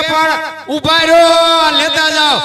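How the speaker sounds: calling out. Acted. Host shouts after the guests.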